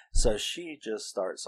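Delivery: ordinary delivery, nothing marked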